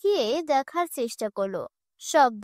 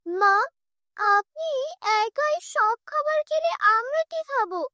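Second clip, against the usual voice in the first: high, pleading voice; pleading son; 10 semitones higher than usual. Acted. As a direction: beg the mother for a share of the food.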